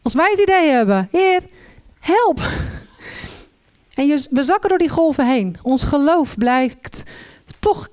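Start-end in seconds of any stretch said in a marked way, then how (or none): 1.1-2.4 high, pleading call, short of a shout; acted cry for help. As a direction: act out calling for rescue. Voices believers sinking, calling for help.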